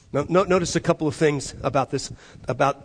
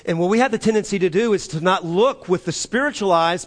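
ordinary talk, nothing marked

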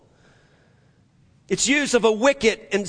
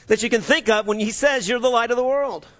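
normal talking voice

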